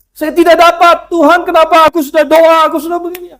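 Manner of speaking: high, loud whining complaint to God; whiny sulking. Retold or imitated. Churchgoer sulking at God, high whining protest. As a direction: whine like a child denied a toy. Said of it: "Dia ngambek sama Tuhan".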